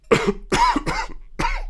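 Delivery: a sharp sneeze caught on camera; real sneeze. Real, not acted. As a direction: let a real sneeze burst out. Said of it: "Ooh, I'm gonna sneeze".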